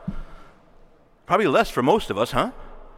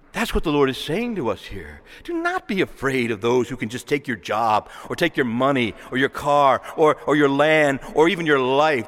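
ordinary talk

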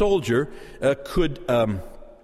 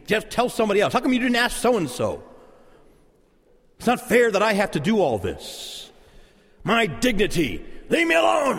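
put-upon complaint building to a high outburst; escalating anger; 4 semitones higher than usual. Acted. Person asked for help, mock anger escalating to a high protest. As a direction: ramp up from grumbling to an indignant cry. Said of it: "start to get angry and we start to escalate".

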